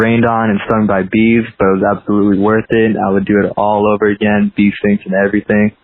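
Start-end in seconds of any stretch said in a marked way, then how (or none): none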